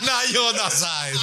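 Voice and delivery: high giggly teasing; laughing teasing. Real, not acted. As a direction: tease him through laughter.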